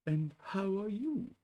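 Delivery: high slow Pooh bear voice. Acted. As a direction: pitch it up for a friendly little bear.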